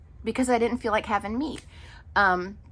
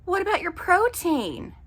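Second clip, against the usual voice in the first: higher, mock-concerned question; concerned cashier; 9 semitones higher than usual. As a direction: lightly mimic a worried cashier. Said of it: "the cashier was like".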